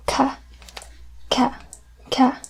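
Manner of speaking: Ka said with a hard K; hard C sound. Shown as a demonstration. Teacher demonstrates hard C to students, ka. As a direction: clip out ka, ka, ka with a hard K. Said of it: "a hard C".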